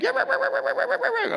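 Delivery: high yappy barks; dog barking. Retold or imitated. Preacher imitating a dog guarding its yard, high barking. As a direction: bark like an angry dog at the gate. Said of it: "your dog's gonna, like".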